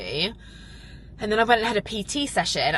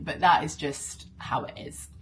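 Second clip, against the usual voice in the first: whispered aside. Real, not acted. Admitting forgetfulness to viewers, whispered aside. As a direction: drop it as a whisper.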